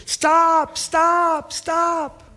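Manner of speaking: high shrieked stop, repeated; imitating the old lady's shrieks; screaming stop. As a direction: shriek it three times, fading on the last. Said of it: "She'd scream".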